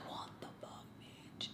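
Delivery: voiceless whispered aside; whispered disbelief. Retold or imitated. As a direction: whisper it like a thought you can't say aloud. Her stunned aside under her breath.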